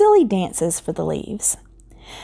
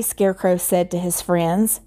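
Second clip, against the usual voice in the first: plain reading voice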